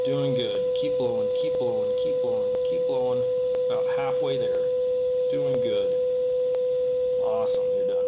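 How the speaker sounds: high, loud cheering on; encouraging coach. Real, not acted. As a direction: urge them to keep blowing. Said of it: "coaching the breath test".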